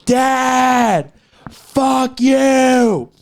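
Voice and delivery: high, loud acted yell; shouting at dad. Friend reenacting a movie, yelling at his dad. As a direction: yell it at your father like a movie scene.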